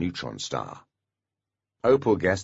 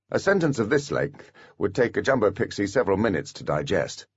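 ordinary delivery

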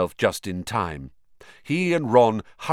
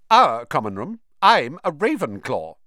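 louder, indignant correction; stiffly indignant; 5 semitones higher, 6 dB louder than usual. Acted. Ravenclaw girl corrects Ron, stiffly indignant. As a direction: set them straight, miffed. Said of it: "said the girl stiffly".

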